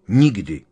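NIKDY as a loud, falling verdict; offended emphasis. A narrator's reading. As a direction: hit the word NIKDY hard, affronted. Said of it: "Hermiona se zatvářila uraženě". (důrazné, uražené NIKDY)

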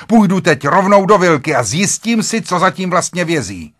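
high, loud, determined threat; heated resolve. A narrator's reading. Husband tells wife he will enter the cottage, heated resolve.

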